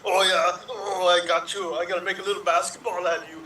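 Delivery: ordinary delivery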